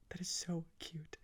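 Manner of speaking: whispered, melting; soft voiceless gush; hushed adoration. Real, not acted. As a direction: whisper it, overcome by cuteness. Overcome by how adorable it is.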